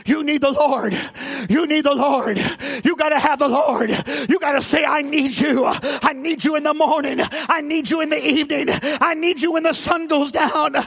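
loud, driving sermon cadence; full-force preaching. Real, not acted. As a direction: drive each phrase home to the congregation.

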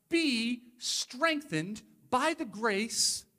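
slow, weighty "be strengthened by the grace"; slow emphasis. Acted. Paul tells Timothy slowly, each word weighted. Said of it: "Be strengthened. It's an interesting phrase".

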